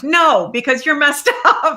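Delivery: laughing rebuff. Acted. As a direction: dismiss them, laughing as you go. Mock rebuff, breaking into laughter.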